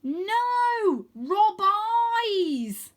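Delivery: high, loud insistent correction; girl corrects boy from a distance; correcting call. Acted. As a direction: correct him firmly from afar. Rose correcting.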